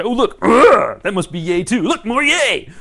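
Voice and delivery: loud, high, gleeful; delighted discovery. Pretend. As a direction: greet each new feeling with glee. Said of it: "And you say".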